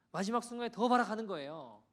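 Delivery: rising sermon emphasis on the last moment; preaching climax. Acted. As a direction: proclaim it to the congregation.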